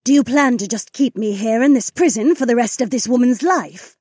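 loud, high-pitched villainous taunt; sneering evil spirit. A narrator's reading. Captured spirit challenges her jailers, sneering. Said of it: "the spirit sneered".

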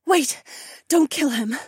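high-voiced urgent plea; shouted plea. A narrator's reading. Sister stops fighter killing the mage, urgent high call. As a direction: call out to stop him, high. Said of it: "his sister began to shout".

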